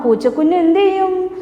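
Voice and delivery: ordinary delivery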